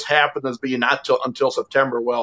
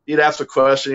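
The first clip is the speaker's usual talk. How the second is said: normal talking voice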